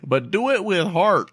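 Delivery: joining in a mock southern twang; high, loud southern imitation; southern accent. Joins the southern character bit.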